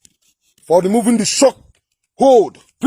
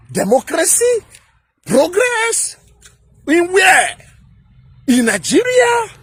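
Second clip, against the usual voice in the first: rising higher and higher in disbelief; incredulous retort; 4 semitones higher than usual. Retold or imitated. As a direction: scoff at each word, climbing ever higher. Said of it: "I said".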